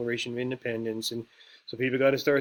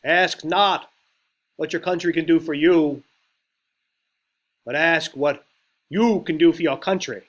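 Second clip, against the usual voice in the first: put-on Massachusetts accent, loud and raised; John F. Kennedy; 4 semitones higher, 10 dB louder than usual. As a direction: orate in a Massachusetts accent, with pauses between phrases. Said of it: "That was my best Massachusetts accent".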